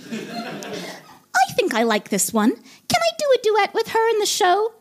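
put-on diva, slow start rising high; puppet diva voice. Diva asks for a duet with the newcomer, put-on coo. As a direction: draw it out, then lift into a high coo. Piggy speaks again, softening to fan.

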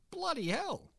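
high, hammy outback shock; caller's shocked reply. Acted. Caller reacts to the news, high comic voice. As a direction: gasp it out as the caller.

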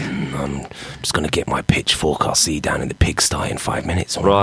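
low, breathy creep voice, barely voiced; creepy pervert. Creepy old farmer greets young man in a low breathy voice. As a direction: leer at the boy in a low creepy rasp. Still playing pervert Jim.

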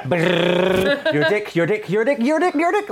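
rising to the top. Retold or imitated. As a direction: chant climbing into a squeaky falsetto. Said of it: "all the way to the top of my head".

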